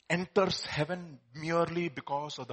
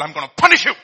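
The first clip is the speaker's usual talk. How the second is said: mock threat; 13 dB louder, much faster than usual. Preacher voices what God does not do to people, loud mock threat. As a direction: burst loud on "punish".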